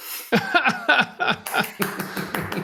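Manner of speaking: real burst of high laughter, trailing off softly; hearty laugh. Real, not acted. Practitioner amused after calling the client a star student, laughing.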